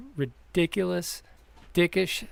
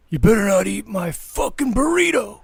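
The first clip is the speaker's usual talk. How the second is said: higher, louder threatening warning; mock angry roommate; 8 semitones higher, 6 dB louder than usual. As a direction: lay down the law over the burrito. Roommate warning about his burrito.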